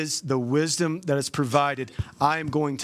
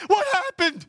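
mock high whine, much higher; whiny bewildered voice; 13 semitones higher than usual. Stubborn advice-seeker asks pastor after the crash, mock high whine. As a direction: squeak it out like a clueless victim. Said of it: "they go back to you and say".

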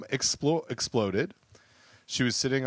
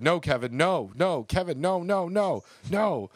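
high-pitched mock yell of a curling skip; imitated yelling; 8 semitones higher than usual. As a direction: imitate yelling at a teammate, pitch climbing with each repeat. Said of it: "was being yelled at by".

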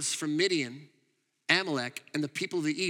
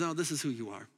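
soft, lower, tender reassurance; gentle affirmation; 6 semitones lower, 5 dB quieter, faster than usual. Acted. God calling Gideon who he is, softly and warmly. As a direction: say it like a father blessing a child.

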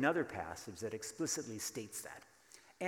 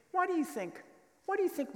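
squeaky question; 5 dB louder, faster than usual. Real, not acted. Playful question to congregation.

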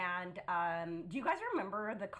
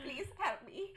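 laughing; 5 semitones higher than usual. Pretend. Voicing the gerbil, laughing.

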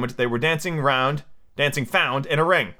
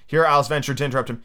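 plain reading voice

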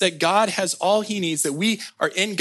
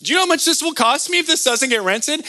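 whiny protest; 9 semitones higher, faster than usual. Retold or imitated. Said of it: "And I was like".

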